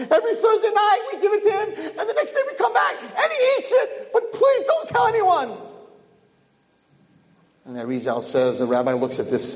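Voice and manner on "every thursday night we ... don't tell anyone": high, eager, naive telling; eager confession. Acted. Peasant confiding his challah plan to the rabbi, eager. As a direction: spill the secret proudly.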